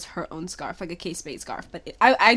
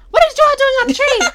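high, loud mock-mom shriek; exaggerated, high-pitched mom yell; mom's shocked question; 14 dB louder than usual. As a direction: imitate an astonished mother, loud and high. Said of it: "she's like".

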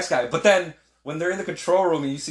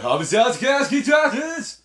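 high, slow, loud mock Russian; 12 semitones higher, 8 dB louder, slower than usual. Retelling the film's Russian line in mock Russian. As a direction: ham up garbled Russian. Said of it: "whatever he said in Russian".

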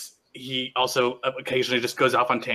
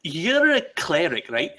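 Nyctis curious; 5 semitones higher, 3 dB louder than usual. Acted. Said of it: "he's like".

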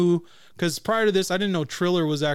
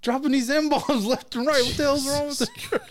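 high-pitched mock disbelief; hammed-up astonishment; retold shocked reaction; 10 semitones higher than usual. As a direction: squeal it incredulously, half laughing. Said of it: "So I was like".

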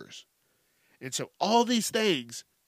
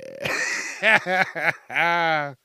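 laugh; 3 semitones higher, 3 dB louder than usual. Real, not acted. Laughing at own joke.

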